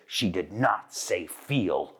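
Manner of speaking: low, slow, 'Phil' said like 'feel'; deliberate correction, with 'Phil' as 'feel'; correcting the vowel. Retold or imitated. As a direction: stress NOT and give 'Phil' a long ee. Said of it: "she says".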